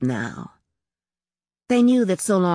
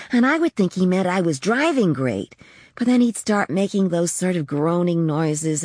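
ordinary delivery, nothing marked

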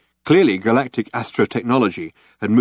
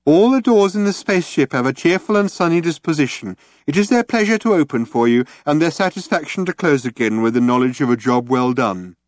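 cheerful, high brochure voice; mock sales pitch; 6 semitones higher, 3 dB louder than usual. A narrator's reading. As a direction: mimic a chirpy ad read. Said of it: "mimicked the style of the sales brochure".

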